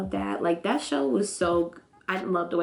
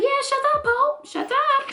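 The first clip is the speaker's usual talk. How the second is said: high mocking pile-on. Vlogger joining JJ in teasing Pope, squeaky high. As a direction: squeal it far higher, teasing. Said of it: "And I was like".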